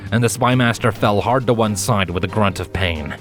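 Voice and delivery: usual speaking voice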